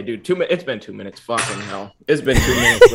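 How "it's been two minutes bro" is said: laughing. Real, not acted. Laughing relief at time up.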